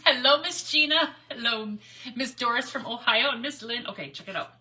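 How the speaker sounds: ordinary delivery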